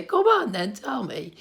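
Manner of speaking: high, bright coaxing mum voice; playful mum asking to be told. Retold or imitated. Mum asking the giggling kids to share the joke, high playful coax. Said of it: "she would say".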